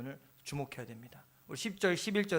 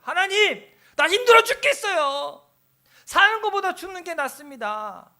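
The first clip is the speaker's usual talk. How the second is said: whiny complaining prayer; 12 semitones higher, 15 dB louder than usual. Retold or imitated. A believer wailing his complaint to God, high and loud. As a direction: moan it out like a worn-out believer. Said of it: "그럼 뭐라고 얘기해?".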